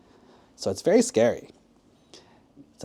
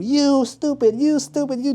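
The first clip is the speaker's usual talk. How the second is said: high-pitched insulting tirade; angry yelling; 13 semitones higher than usual. Acted. Stranded swimmers yelling at the prankster, angrily. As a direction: berate him at the top of your range. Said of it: "they're just yelling at him".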